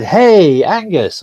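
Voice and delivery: bright, overly chummy hello; mock-friendly message greeting; cheesy automated greeting. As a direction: read it like a salesy bot message. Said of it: "And it said".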